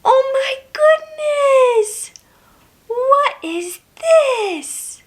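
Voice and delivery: high-pitched wide-eyed wonder; playful amazement. Pretend. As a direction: marvel at a surprise for a toddler. Acts amazed at next toy animal.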